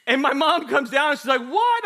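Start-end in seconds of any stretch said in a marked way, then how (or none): 1.4-1.9 high, loud mock "what"; alarmed mother. Mother coming down to her yelling son, alarmed. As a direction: call out in exaggerated alarm.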